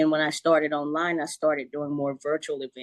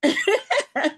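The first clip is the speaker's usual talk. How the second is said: laughing; 12 semitones higher, 3 dB louder, faster than usual. Real, not acted. Laughing about spilled tea on laptop.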